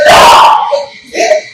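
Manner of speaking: retold cry of no. Reacting to the rival's victory video.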